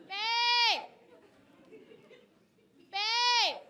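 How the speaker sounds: high, loud calling across the house. Retold or imitated. Wife calling for her husband at home, loud and high. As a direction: shout for him through the house. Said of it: "he gets three babes".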